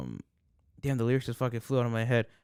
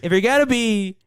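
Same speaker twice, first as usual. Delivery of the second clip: loud, raised debate-speech voice; Trump; 9 semitones higher, 5 dB louder than usual. Acted. Friend mimics speaker as debating candidate, loud mock oratory. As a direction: orate loudly like a candidate. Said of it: "like fucking Trump right now".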